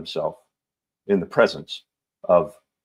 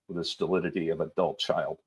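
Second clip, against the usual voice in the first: plain reading voice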